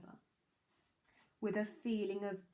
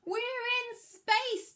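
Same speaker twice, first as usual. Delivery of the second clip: high, slow, stretched cry of wonder; thrilled yell; 13 semitones higher, 7 dB louder, much slower than usual. Acted. Danny yelling with joy as they float in space. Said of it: "Danny yelled".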